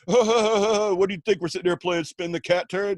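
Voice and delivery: high, loud, slow, laughing disbelief; laughing mockery. Stoner laughing at the naive guy, high and loud. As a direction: laugh at him while asking.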